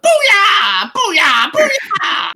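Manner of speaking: high, shrill-pitched goblin war cry; yelling goblin chorus. Acted. Game master voices goblins charging at the players, high yell. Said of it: "goblin voices yell at".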